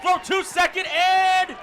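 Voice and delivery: high, loud shouted baseball call; excited play-by-play. Real, not acted. Announcer calls a run-scoring double, excited shouting. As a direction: shout the runners round the bases.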